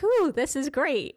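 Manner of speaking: imitating thrilled thrill-seekers, high and loud; a bright burst of imitated delight; excited mimicry. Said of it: "they're like".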